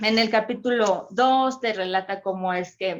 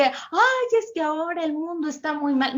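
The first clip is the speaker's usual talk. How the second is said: mock complaining; 7 semitones higher than usual. Imitating what people think.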